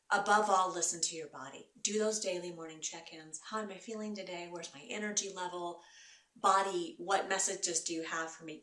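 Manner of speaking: ordinary delivery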